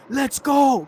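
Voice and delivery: mock scream. Retelling Suh screaming in the commercial, mock scream. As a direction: imitate an excited scream without going all out. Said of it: "Suh touches it and screams".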